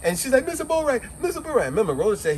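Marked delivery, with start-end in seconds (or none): 0.5-1.7 frantic calling. Retold or imitated. Annette shaking him again.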